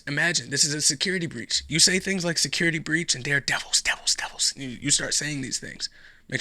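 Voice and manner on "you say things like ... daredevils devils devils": whispered Devils, Daredevils with a creepy hiss; spooky whisper. Pretend. Whispering the label like a spooky chant. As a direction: hiss it like a ghost story.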